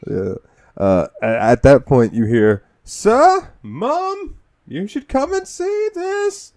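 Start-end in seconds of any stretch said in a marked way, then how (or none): 2.8-6.5 very high, slow call. Retold or imitated. Robot calling family in game.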